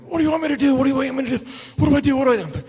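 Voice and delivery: high, loud, frantic questions; panicked Vader. Retold or imitated. Vader fussing over the wrestler he just hurt. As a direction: imitate a big man suddenly panicking.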